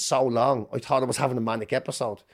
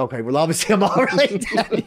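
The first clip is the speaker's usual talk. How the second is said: laughing; 6 semitones higher than usual. Retold or imitated. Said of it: "I remember laughing my head off".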